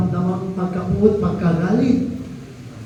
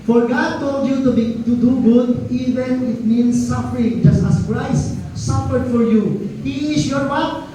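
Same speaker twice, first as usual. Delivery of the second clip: raised, slow scripture reading; 6 semitones higher, slower than usual. Acted. Pastor reads scripture to congregation, raised and slow. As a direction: read the verse out to the church, slowly.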